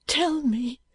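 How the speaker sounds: put-on high, pleading boy's voice; a high, begging plea; imploring boy. A narrator's reading. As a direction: beg him to answer. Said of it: "said the boy imploringly".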